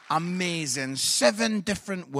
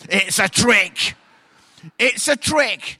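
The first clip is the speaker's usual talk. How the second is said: louder and slower each time; loud slow warning; 9 dB louder, slower than usual. Shown as a demonstration. Warning the men not to fall for it, slow loud warning.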